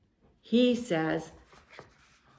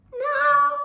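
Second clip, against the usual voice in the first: high, loud pigeon squawk; 6 dB louder than usual. Acted. Pigeon echoing our refusal, high squawky voice. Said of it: "and he says".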